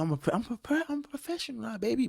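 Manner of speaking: jokey falsetto boast; mock professional boast. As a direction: brag like a cartoon showman up high. Boasting about being a pro.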